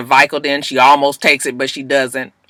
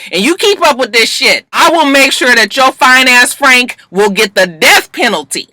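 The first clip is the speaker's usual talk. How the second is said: higher, louder threat; angry threat; 9 semitones higher, 4 dB louder than usual. Retold or imitated. Attorney general threatening Annalise, raised and angry. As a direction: threaten her with the worst.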